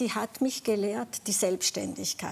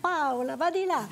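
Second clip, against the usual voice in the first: high, lilting imitated beach call; Italian parent calling; 6 semitones higher than usual. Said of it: "als Kind am Strand war, in Italien". (hoher italienischer Ruf, nachgeahmt)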